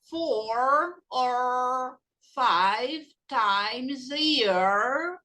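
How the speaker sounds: very slow, word-by-word teacher model; drawn-out classroom drill; repeat-after-me slow. Shown as a demonstration. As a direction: stretch each word out for learners to repeat. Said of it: "Repeat after me".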